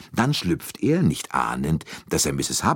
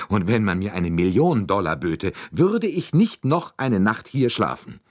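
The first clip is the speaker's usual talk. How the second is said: higher, emphatic refusal by an upset lady; indignant lady passenger; 5 semitones higher, 3 dB louder than usual. A narrator's reading. American lady refusing to sleep in her compartment, indignant. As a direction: dig your heels in, outraged.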